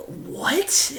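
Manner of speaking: high, incredulous what?; can't believe what she's hearing; sharp rising disbelief; stunned what. Real, not acted. Her disbelief at the guru.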